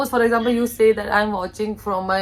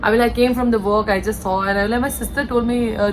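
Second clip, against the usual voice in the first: ordinary delivery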